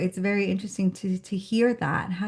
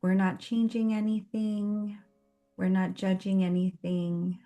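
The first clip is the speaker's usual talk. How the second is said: guided meditation. Real, not acted. Host grounding a meetup group before the session, guided meditation. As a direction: guide them gently through each breath, unhurried.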